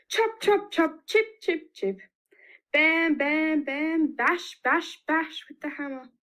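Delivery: chopping sound effects. Shown as a demonstration. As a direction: sound out the hammering for the children. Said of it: "help me with some of these sounds".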